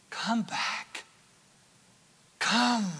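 breathy whispered plea. Shown as a demonstration. Preacher to straying listeners, hushed whisper. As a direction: whisper it like a secret invitation.

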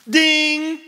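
high, loud, sustained "ding"; mock lightbulb-moment chime; imitated bell ding. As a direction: ring out like a bell. Imitates a bell of sudden realization.

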